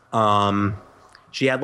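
normal talking voice

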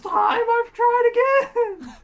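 high, loud whining protest; exasperated wail. Real, not acted. Streamer told to try again after failing, exasperated wail. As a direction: wail it in comic despair.

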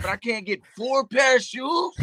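high, sharp indignant snap; snapping. Retold or imitated. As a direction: snap back in disbelief. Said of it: "I didn't mean to say it like that".

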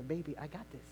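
low, hushed comfort; soft divine reassurance. Acted. God telling his child not to worry, softly. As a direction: soothe like a patient father. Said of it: "That's what God is saying".

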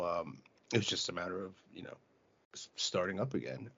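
normal talking voice

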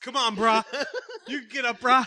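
high, put-on bro voice; bro-dude encouragement. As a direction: egg him on like a dude-bro. Plays the rescuer urging him up.

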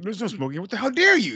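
a killer's prissy high scold; high mock outrage. Imitating the killer scolding the nun, high and outraged. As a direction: fake indignation, rising high.

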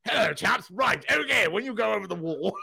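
high, hammed-up plummy RAF voice; posh British officer. Fan imitating the British resistance in the sitcom, hammed-up officer. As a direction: play a jolly wartime officer. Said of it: "when the British resistance talked about".

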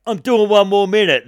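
loud, high call to a crowd; defiant shout. Retold or imitated. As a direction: shout it out over the hecklers.

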